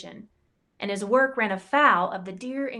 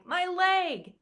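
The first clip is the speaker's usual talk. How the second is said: sudden pained cry, pitched up; cried out in pain; 8 semitones higher than usual. Acted. Knight falling hurt cries out in pain. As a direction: yelp it as the leg breaks. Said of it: "Our guy cried".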